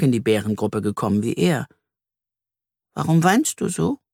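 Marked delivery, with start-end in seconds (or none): 2.9-4.0 lightly voiced curious little girl; small girl asking. A narrator's reading. Jule asks the crying boy why he cries, lightly voiced curious girl. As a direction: ask gently, wide-eyed. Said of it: "will sie wissen".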